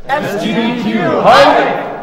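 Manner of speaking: loud, high, drawn-out crowd cheer; group hype shout. Pretend. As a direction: yell it all together, stretching every letter. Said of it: "get the whole room to coordinate".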